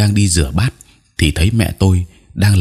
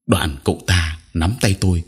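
plain reading voice

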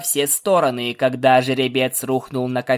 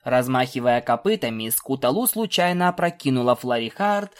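usual speaking voice

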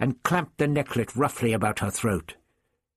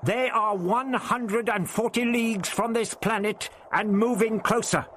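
put-on high, firm old man insisting; old Doctor, firm; 11 semitones higher than usual. A narrator's reading. The Doctor bargains with the voice, firm. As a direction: stand your ground, lay out the facts.

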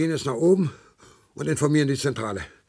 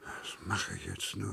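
muttering to himself; 7 semitones lower, 15 dB quieter, slower than usual. Acted. Alone, despairing to himself.

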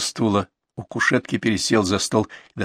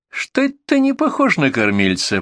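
mild scorn, pitched up; contemptuous; 7 semitones higher than usual. A narrator's reading. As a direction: sneer at the excuse. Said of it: "презрительно произнёс фельдшер".

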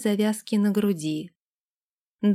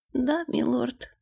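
bashful maid; 4 semitones higher, 3 dB quieter than usual. A narrator's reading. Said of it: "Та покраснела до кончиков ушей".

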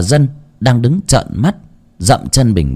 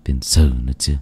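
gloomy grumbling. A narrator's reading. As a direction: grumble bitterly to yourself like an old grandfather. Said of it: "ông cũng buồn rầu mà chửi".

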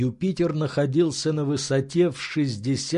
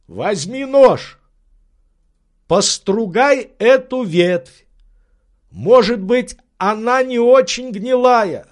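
Legrand's orders; 9 semitones higher, 7 dB louder than usual. Acted. Legrand telling his servant to test the branch, loud. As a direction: instruct firmly, hoping.

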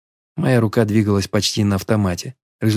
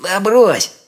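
squeaky, much higher bandit; 10 semitones higher, 3 dB louder than usual. A narrator's reading. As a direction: pitch it up into a squeak. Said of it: "писклявым голосом сказал он".

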